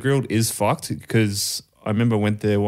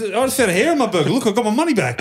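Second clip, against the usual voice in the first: high, fast outraged bloke; agitated bald stranger; 10 semitones higher, faster than usual. Retold or imitated. Bald man bragging about his refund, high fast outraged voice. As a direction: gabble it out, waving the refund. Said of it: "He goes".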